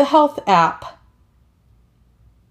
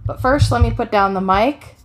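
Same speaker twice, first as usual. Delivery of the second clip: usual speaking voice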